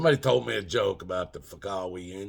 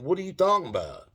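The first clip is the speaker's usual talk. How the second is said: indignant; 8 semitones higher, faster than usual. Retold or imitated. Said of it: "I'm going".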